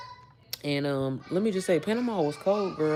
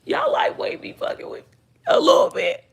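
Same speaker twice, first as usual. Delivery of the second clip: squeaky high plea turning to a whispered whine; half-joking fake desperation; mock exasperation. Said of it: "I'm like".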